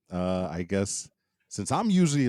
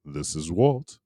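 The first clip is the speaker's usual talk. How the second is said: a touch brighter, louder host voice; CrossGen voice; 3 semitones lower, 4 dB louder than usual. Shown as a demonstration. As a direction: sign in with your other show's voice. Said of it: "in my CrossGen voice".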